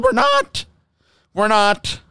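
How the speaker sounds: replaying his exasperated outburst; mock shriek. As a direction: shout the denial at him. Retold sarcastic denial.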